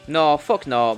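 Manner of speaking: ordinary delivery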